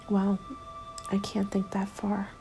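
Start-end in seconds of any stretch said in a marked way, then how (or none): none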